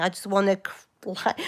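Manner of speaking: usual speaking voice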